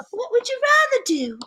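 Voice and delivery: high-pitched, badly done accent. Singer asking a model her ambitions, bad imitated accent. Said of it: "I'm doing a very bad [name] accent".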